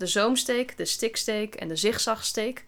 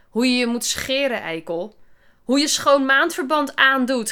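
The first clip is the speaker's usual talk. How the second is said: raised voice, higher and louder; heated schoolkid's retort; mild narrated yell; 5 semitones higher, 7 dB louder than usual. A narrator's reading. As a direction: yell it, but not at full force. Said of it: "gilde Kelsey".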